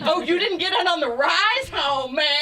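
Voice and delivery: normal talking voice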